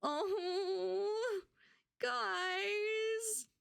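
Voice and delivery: high, breaking voice from real tears; crying. Real, not acted. Overwhelmed streamer to her followers, crying. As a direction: let the tears take over. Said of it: "you've broken me".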